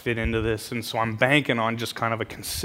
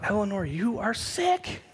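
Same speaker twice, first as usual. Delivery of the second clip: much higher, slow wounded whine; high indignant whine; 8 semitones higher, 4 dB quieter, slower than usual. Retold or imitated. To the laughing old lady, a high, slow offended whine. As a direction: squeak it out, offended and bewildered. Startled when she laughs.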